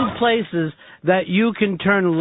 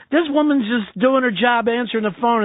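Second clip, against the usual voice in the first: normal talking voice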